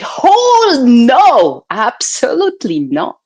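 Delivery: loud, high-pitched acted refusal; high outburst of refusal; emphatic refusal. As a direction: cry out a drawn "no", then settle. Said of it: "my entire body said".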